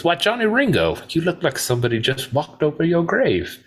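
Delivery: high, drawn-up gunslinger impression; acted Old West greeting, pitch swooping high; Doc Holliday. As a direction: taunt a rival in a high-pitched character voice. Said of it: "he goes".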